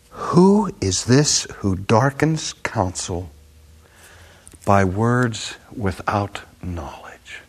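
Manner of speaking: slow, measured rebuke fading to a whisper; voice of God. Acted. God rebuking Job, slow and sinking to a whisper. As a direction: deliver God's rebuke slowly, then hush. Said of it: "And he said this".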